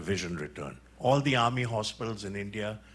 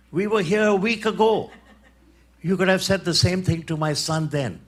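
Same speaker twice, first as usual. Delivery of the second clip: higher, louder, half-laughing mother's reproach; 8 semitones higher, 7 dB louder than usual. Retold or imitated. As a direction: tease him with a laugh for the wasted week. Mother challenges Gandhi, laughing.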